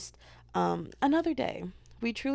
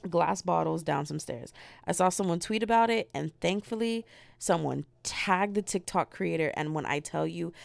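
usual speaking voice

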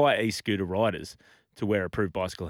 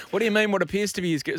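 higher, querying mock operator; puzzled operator; 7 semitones higher, faster than usual. Triple O operator questioning a caller, mock puzzlement. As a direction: query the caller's odd wording, then break off. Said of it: "Triple O".

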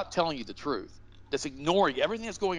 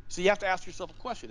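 ordinary delivery

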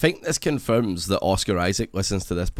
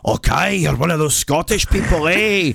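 high, loud bad Scottish impression; terrible Scottish accent; 7 semitones higher, 5 dB louder, slower than usual. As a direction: ham up a clumsy Scottish accent. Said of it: "their terrible Scottish accents".

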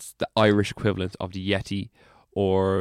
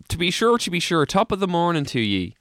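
lightly hammed-up stage Irish lilt, higher; stage Irish accent; 8 semitones higher than usual. Cryptid introduced as Irish, light stage Irish accent. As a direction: play a hint of stage Irish leprechaun. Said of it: "An Irish cryptid".

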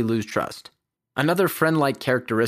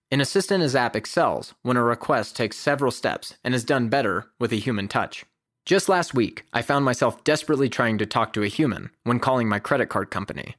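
ordinary talk

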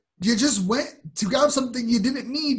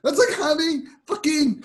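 exasperated argument; 8 semitones higher than usual. Real, not acted. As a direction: hammer each point home in exasperation. Ranting with a toilet analogy.

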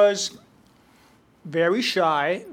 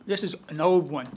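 ordinary talk

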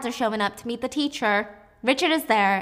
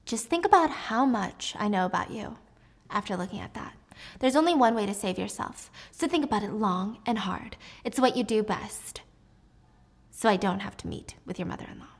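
normal talking voice

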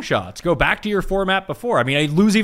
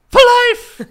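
high, loud mock shout; 11 dB louder than usual. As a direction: shriek it out. Mock banning a listener.

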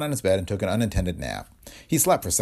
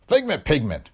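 growled; 8 semitones higher, 4 dB louder than usual. A narrator's reading. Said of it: "growled Blount".

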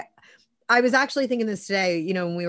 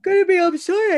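words stretched by a yawn; 12 semitones higher, 7 dB louder than usual. Real, not acted. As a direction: talk through a real yawn. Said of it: "I keep yawning".

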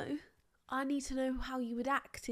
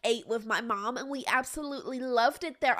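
put-on ditzy American girl; 3 semitones higher, 4 dB louder than usual. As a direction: play a starstruck sorority girl. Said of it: "I'm gonna be the girl".

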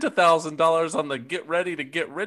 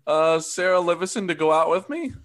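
ordinary talk

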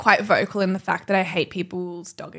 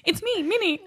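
much higher, giggling through it; dog voice; 9 semitones higher, 4 dB quieter than usual. Pretend. Owner voicing Minnie high, laughing. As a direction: squeak it out like a puppy.

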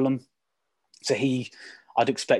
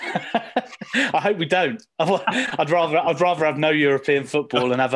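laughing; 6 semitones higher, 3 dB louder, slower than usual. Real, not acted. Laughs at Europa League question.

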